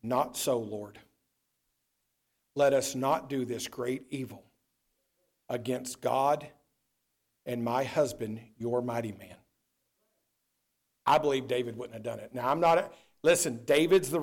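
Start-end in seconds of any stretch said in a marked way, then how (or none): 0.0-9.4 lightly voiced, low and soft plea; a hint of Bathsheba refusing the king; barely voiced wife pleading; imagined Bathsheba. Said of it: "if Bathsheba would have said".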